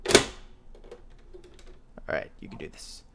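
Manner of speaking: whispered pep talk to himself; whispering. Real, not acted. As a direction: whisper it to yourself. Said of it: "self pep talk".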